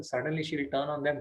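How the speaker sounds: normal talking voice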